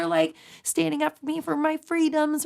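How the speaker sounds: put-on high child's voice thanking the truckers; little kid voice. Kids calling in on the trucker radio, high and sweet. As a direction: pipe up like a small kid on the radio. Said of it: "just little voices".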